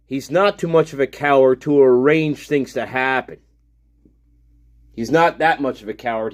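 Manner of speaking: normal talking voice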